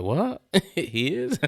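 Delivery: high, rising disbelief broken by laughter; mock shock. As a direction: imitate stunned surprise while cracking up. Retold shock at cheating news.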